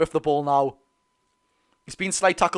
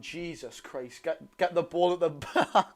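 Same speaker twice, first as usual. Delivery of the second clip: laughing; 10 dB quieter, slower than usual. Real, not acted. Reading a comment while laughing.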